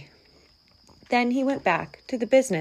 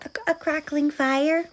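high, tentative mouse voice; small, stammered hopeful question; timid venture; 8 semitones higher than usual. A narrator's reading. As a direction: venture it hesitantly as a tiny mouse. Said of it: "ventured the mouse".

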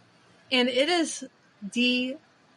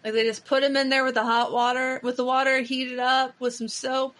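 ordinary delivery

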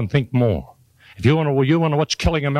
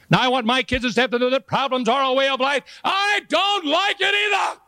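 mock distress; 13 semitones higher than usual. Boss telling kids and staff about problems, rising mock wail.

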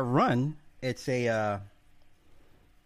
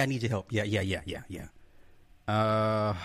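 ordinary talk, nothing marked

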